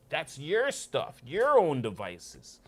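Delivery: high, slow and loud scolding; slow emphatic condemnation; stern rebuke. Acted. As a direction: drive the verdict home word by word. Wisdom condemning.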